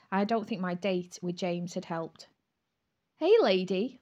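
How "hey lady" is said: louder greeting that cuts through; piercing call. A narrator's reading. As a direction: call out to get her attention. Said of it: "Leon's voice pierced through".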